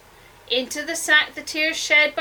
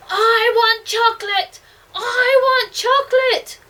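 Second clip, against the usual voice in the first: high, loud, demanding cry; furious childish bawl; bawling tantrum; 10 semitones higher, 7 dB louder than usual. A narrator's reading. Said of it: "bawled the girl".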